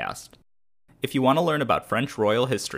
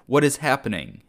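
higher, louder cry of confusion; fearful cry; 6 semitones higher, 5 dB louder than usual. A narrator's reading. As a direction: cry out in panic from the ground. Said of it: "he cried ... in a mixture of fear, panic".

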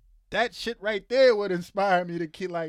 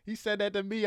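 ordinary talk, nothing marked

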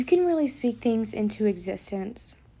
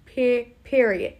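slow cue-along; 4 dB louder, slower than usual. Shown as a demonstration. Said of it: "let me hear you say it".

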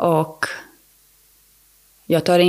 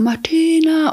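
retold yell; 11 semitones higher, slower than usual. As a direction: call the name like a panicked husband from the pool. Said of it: "han skriker och skriker".

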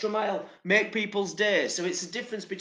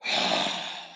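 impatient sigh. Retold or imitated. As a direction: huff out your impatience. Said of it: "really deliberate shake of the head".

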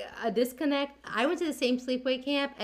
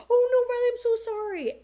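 high, louder wailing sorry; upset apology; 14 semitones higher, 6 dB louder than usual. Retold or imitated. Friend apologising after the burn, high and upset. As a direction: apologise in a rush of distress. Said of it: "She's like".

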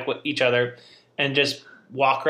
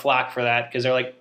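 ordinary talk

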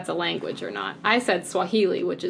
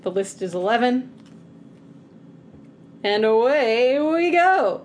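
meeting-leader voice; 3 semitones higher, 4 dB louder than usual. Pretend. Host launches the round to annoy co-player, mock meeting-leader voice. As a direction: announce it louder, swooping high at the end. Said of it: "I'm in my leading a meeting voice".

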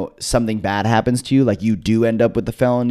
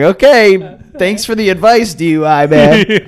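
higher and louder, slowing on the name; mock grateful sarcasm; 9 semitones higher, 6 dB louder than usual. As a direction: tease the DUI man with fake thanks. Sarcastic thanks to drunk inmate.

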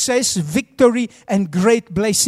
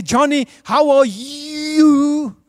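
very slow mock minister greeting; pompous minister; 2 semitones higher, much slower than usual. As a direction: greet a child with absurd solemnity, stretching the last word. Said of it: "Do you speak like that".

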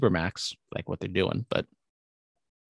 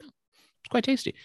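much higher, a prim dainty lady; mock posh voice; 4 dB quieter, faster than usual. Host mocking his fancy ice cream taste, mock posh voice. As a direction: lift into a fussy high-society taster. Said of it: "It's like".

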